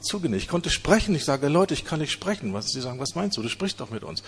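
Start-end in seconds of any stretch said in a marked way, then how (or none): none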